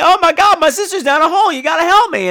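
high, loud pleading voice; panicked brother. Retold or imitated. Brother begging the tennis players to help, high panic. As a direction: beg the strangers for help. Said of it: "and he's like".

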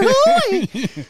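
squeaky high cartoon mouse; cartoon mouse falsetto; Mickey Mouse voice. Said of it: "A little bit of Mickey Mouse in there".

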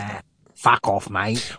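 slow, drawn-out mock London dismissal; London accent. London dealer dismissing the customer, slow mock London accent. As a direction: send him packing in a put-on London accent. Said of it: "we're really nailing the accents".

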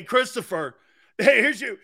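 ordinary talk, nothing marked

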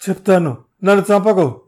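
high, loud begging cry; terrified scream. Acted. Thug about to be burned, terrified begging scream. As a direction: scream for mercy. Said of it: "అరిచాడు".

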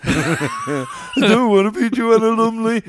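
high, loud, slow laughing whine; whiny protest. Retold or imitated. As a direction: wail it like a kid refusing the part. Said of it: "Which one of your mates was like".

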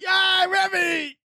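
high, slow squealing cheer for the hero; cheering rats. Pretend. Baby rats cheering Remy home with dinner, high and excited. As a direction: squeal it out like a crowd of happy rats. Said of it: "they're gonna be like".

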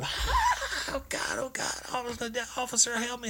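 mock hysterical squeal, very high; hysterical screaming. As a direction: imitate a woman shrieking for help, without full volume. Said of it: "I'm talking about hysterically screaming".

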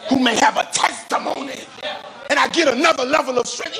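high, impassioned pulpit voice; fervent preaching. Real, not acted. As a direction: lift the voice high as the testimony builds. Preaching at full force.

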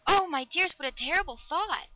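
high, loud, appalled exclamation; distracted cry. A narrator's reading. Lover horrified by the girl's thought, distractedly. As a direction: cry out in dismay at a loved one. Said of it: "he cried distractedly".

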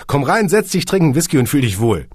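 high, loud, fast eager invitation; eager welcome. A narrator's reading. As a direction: rush to call him back in. Kunze eagerly calling Hagen back.

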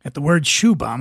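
ordinary delivery

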